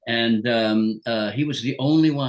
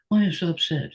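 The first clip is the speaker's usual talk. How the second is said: mild imitated question; 6 semitones higher than usual. Retold dying man's words.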